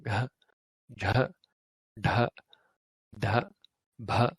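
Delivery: "ga, jh, dh, bh" voiced with a big h burst; like being punched in the stomach; voiced aspirated drill; exaggerated breathy stops. Shown as a demonstration. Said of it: "like you're actually being punched in the stomach".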